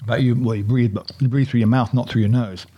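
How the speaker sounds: ordinary talk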